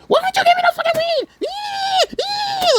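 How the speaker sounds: very high shouted caricature, ending in squeals; hammed-up shrieking complaint; mocking screechy impression. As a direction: imitate a screeching nag, wildly exaggerated. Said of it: "I was gonna make fun of her accent".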